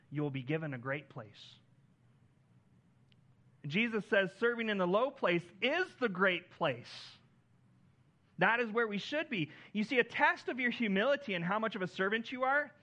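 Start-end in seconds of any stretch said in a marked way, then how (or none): 3.6-12.7 louder, higher preacher's build on servanthood; preaching at full force. Acted. Pastor preaching to the congregation, rising and loud. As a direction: drive the point home from the pulpit.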